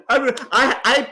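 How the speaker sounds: laughing mid-word. Real, not acted. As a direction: break into a laugh.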